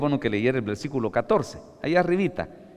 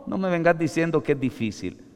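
ordinary delivery

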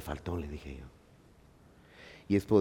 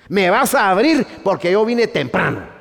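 much higher and louder, hammed-up entitled demand; mock arrogant believer; 10 semitones higher, 8 dB louder than usual. Preacher mocking a believer demanding Pedro open heaven's door, hammed-up. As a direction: play a pushy guy demanding entry at heaven's gate. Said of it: "vamos a llegar".

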